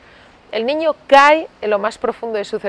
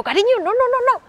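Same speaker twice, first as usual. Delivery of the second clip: mother warning; 4 dB louder than usual. Retold or imitated. Mother stopping daughter at ice cream.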